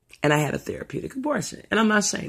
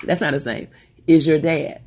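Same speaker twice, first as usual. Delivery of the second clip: normal talking voice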